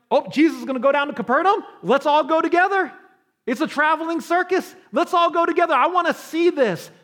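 high, loud, fast mock-eager chatter; eager crowd. Galileans eager to follow Jesus, excitedly chattering. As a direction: play the thrill-seeking crowd. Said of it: "would have been really happy to be like".

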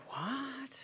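a high, breathless stunned what; stunned disbelief. Retold or imitated. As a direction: let it drop out in shock. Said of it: "And I'm like".

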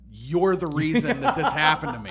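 mock angry, laughing; laughing blame. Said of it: "I'm like".

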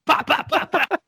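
high, rapid-fire popping sounds; mimicked gunshots. Tony shooting the soda drinker, mimicked high popping gunshots. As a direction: imitate a pistol going off repeatedly. Imitating gunshots after quote.

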